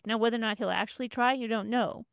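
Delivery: normal talking voice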